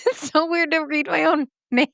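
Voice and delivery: laughing, pitched high. Real, not acted. Flustered host reading her own name in a letter, laughing. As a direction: giggle through the line in disbelief.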